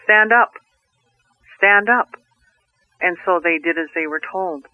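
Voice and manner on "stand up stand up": loud, high commanding voice of the Creator; a loud divine command, raised in pitch; booming command. Acted. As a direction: call them to their feet with a great voice. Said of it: "this big, huge voice said".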